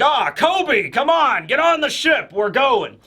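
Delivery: retold call to hurry aboard; lightly acted calling-out; calling someone over. Acted quote calling Kobe to board.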